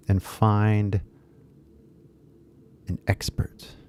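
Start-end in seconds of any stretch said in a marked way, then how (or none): 2.9-3.8 breathy whispered aside. Real, not acted. Teacher whispering a word to students. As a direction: drop to a whisper on an expert.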